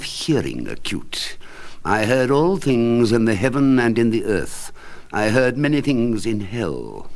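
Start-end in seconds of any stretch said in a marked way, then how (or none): none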